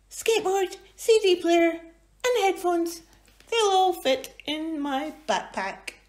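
high excited little voice; Angus voice. Acted. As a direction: rattle off favourite things. Angus listing.

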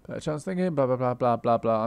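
a mock shout of blah blah; loud mocking blah-blah. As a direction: drown out the nagging with a loud blah blah. Mocking the character's chatter.

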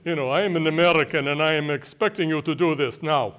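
imitating himself playing the demanding American in Greece; haughty foreigner voice. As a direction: boss them around like a proud visitor. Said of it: "I always talk like this".

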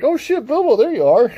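high-pitched comic discovery; hammed-up high voice, amused; mock surprise. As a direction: act startled to suddenly spot a friend. Acts out friends spotting Bilbo.

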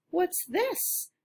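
put-on high, small boy's voice asking; curious little boy. A little boy looking for truck books, high curious voice.

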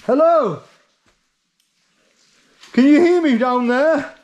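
loud call down a shaft; calling down from tower. Real, not acted. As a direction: shout down to someone far below. Calling down to friend below.

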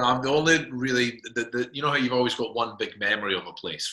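usual speaking voice

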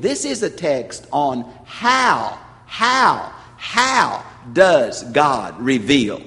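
slow, loud, drawn-out how does God reveal; emphatic on how. Real, not acted. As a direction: hammer the word how, each one climbing. Preacher stresses the key point.

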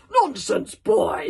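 very loud, gruff dismissal; irritable snap. A narrator's reading. Gobber dismissing Hiccup's warning, snapping. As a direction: shout the boy down. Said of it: "snapped Gobber the Grouch".

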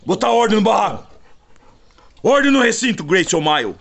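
high, commanding call for order. Real, not acted. Owner putting order in the kennel, commanding.

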